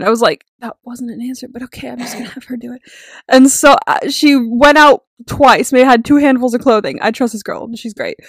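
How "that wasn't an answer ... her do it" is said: quick, very quiet grumble; muttered retold aside. Worker unsatisfied by manager's answer, muttered aside. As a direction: grumble it under your breath, much softer.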